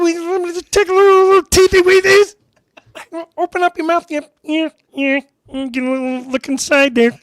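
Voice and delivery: high, loud, strangled cartoonish baby voice; baby-talking dentist. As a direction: coo at a young patient in an exaggerated, high-pitched baby voice. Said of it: "He talked in this baby voice".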